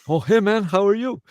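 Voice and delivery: high, loud excited greeting; Robbie Alomar. Retold or imitated. Greeting an old Blue Jays workmate, high and loud. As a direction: imitate a ballplayer's delighted high-pitched hello. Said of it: "I ran into Robbie Alomar".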